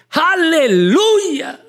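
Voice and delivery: high, long drawn-out hallelujah cry; jubilant shout. Retold or imitated. Believer driving Vicky bursts out with praise, shouting. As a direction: shout it out in praise, stretching it slowly. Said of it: "Y yo grité".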